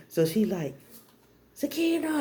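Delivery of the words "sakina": high sing-out of a name; mom calling out. Retold or imitated. Mom calls for her daughter, calling out. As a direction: imitate an old-school mom calling.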